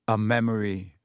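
plain reading voice

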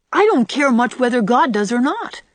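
put-on high, carefree little-boy voice; joyous. A narrator's reading. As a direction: blurt it out with childish glee. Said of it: "said Davy joyously".

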